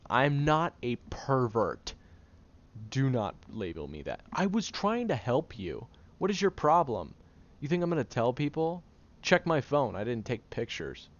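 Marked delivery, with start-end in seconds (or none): 0.1-10.0 high, loud, indignant protest; defensive outburst. Acted. Caretaker accused of being a pervert by the friend he helped, loud defensive anger. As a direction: shout back at the accusation.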